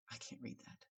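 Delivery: barely audible whisper; acted silent-style plea; mouthed aside. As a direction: whisper it across a room, almost without voice. Retold remark to distant timekeeper.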